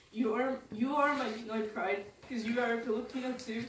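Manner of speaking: ordinary talk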